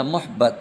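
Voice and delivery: محبت as "MUH-bat", two syllables with u first; pronunciation option. Shown as a demonstration. Teacher reading the last quiz choice, short "muhbat" for محبت. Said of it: "درست تلفظ آپ نے دینا ہے".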